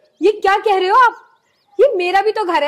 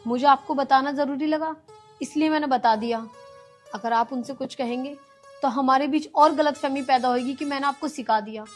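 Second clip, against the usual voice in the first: soft pleading; 3 semitones lower, 5 dB quieter than usual. Acted. Wife calms husband softly.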